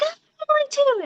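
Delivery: high excited squeal; excited kid. Retold or imitated. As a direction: gush like a thrilled little kid. Kids excited sharing her name.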